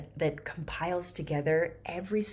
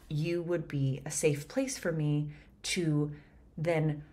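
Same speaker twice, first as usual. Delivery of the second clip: usual speaking voice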